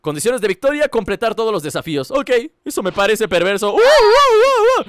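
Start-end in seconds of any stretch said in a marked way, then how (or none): 3.7-4.9 high, slow ape hoots; monkey hooting. Acted. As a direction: hoot like a monkey.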